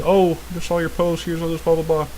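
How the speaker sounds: quiet put-on voice trailing into filler; dismissive mimicry. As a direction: reel off a message as if scripted. Mimics a scripted trade message.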